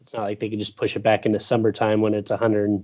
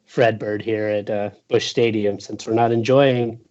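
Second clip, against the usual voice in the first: normal talking voice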